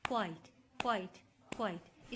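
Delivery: क्वाइट in a single short falling beat, no break inside; isolated one-beat क्वाइट, repeated as a demonstration; one unbroken syllable. Shown as a demonstration. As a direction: say each क्वाइट whole, pausing only between repeats. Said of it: "इसमें हमारे पास sound नहीं break हो रही".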